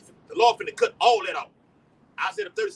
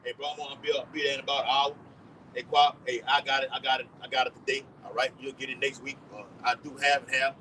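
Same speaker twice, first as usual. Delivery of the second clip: casual phone chat; 8 semitones lower than usual. Retold or imitated. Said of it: "Having conversation with Aki".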